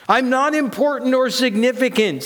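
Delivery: high-pitched protest of worthlessness; insecure inner self. Acted. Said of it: "says my inner self".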